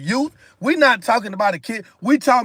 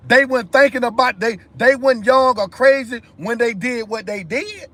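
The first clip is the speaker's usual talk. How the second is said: ordinary delivery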